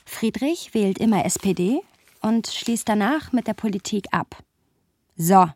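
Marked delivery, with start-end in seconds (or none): 5.2-5.5 loud, clipped So of someone who has had enough; fed-up exclamation. Retold or imitated. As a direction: throw the word out in disgust. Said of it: "sagt er". (lautes, genervtes So)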